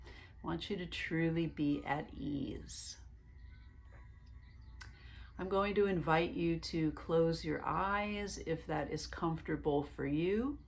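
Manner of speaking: calm meditation guide voice; guided meditation. Acted. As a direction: lead them gently into relaxation, one breath at a time.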